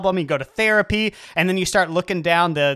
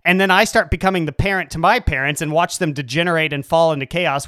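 ordinary talk, nothing marked

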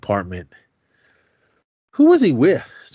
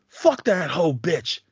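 contemptuous curse; 6 semitones higher, slower than usual. Retold or imitated. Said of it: "His words".

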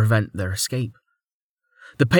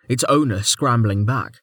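plain reading voice

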